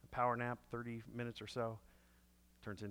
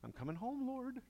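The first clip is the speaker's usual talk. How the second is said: higher, half-joking plea to the Lord; mock panicked prayer; 12 semitones higher than usual. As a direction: pretend you're about to meet God. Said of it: "I'm like".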